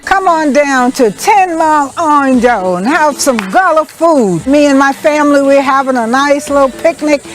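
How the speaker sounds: welcoming host pitch. Acted. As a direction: sell it like a friendly TV invitation. Hostess invites viewers to her picnic.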